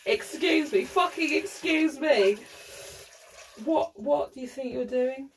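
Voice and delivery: high, affronted mock outrage; royal indignation. Acting out a royal excuse me, indignant and high. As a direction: demand an explanation, high and haughty. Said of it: "it's a royal excuse me".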